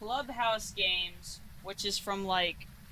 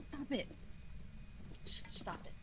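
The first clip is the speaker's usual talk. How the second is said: hushed whisper; 4 semitones higher, 11 dB quieter than usual. Real, not acted. Hiding from ghost, hushed.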